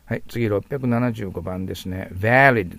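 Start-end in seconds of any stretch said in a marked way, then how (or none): 2.1-2.8 valid said slowly with a bitten V, "velid"; pronunciation model. Shown as a demonstration. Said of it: "と発音します".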